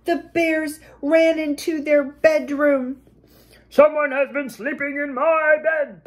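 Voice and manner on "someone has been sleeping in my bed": big, gruff storybook bear bellow; bellowing papa bear. A narrator's reading. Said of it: "Papa Bear bellowed".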